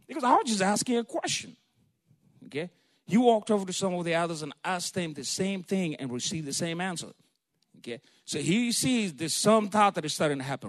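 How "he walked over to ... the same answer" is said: ordinary delivery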